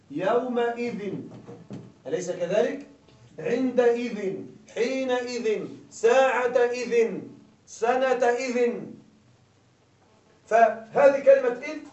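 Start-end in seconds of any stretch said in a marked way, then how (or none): 0.1-8.8 each word slow, the final ذٍ said with nunation; slow example list, the -in ending marked; nunation of substitution. Shown as a demonstration. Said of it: "نقول". (يومئذٍ وأخواتها بتنوين واضح)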